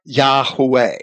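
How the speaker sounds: Yahweh in three syllables, "ya-hu-ay"; suggested old pronunciation. Shown as a demonstration. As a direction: sound out Yahweh as "Yah-hoo-ay". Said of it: "first to suggest the pronunciation".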